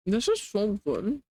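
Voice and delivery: slow words through chewed food; mouth full. Real, not acted. Eating, slurred words.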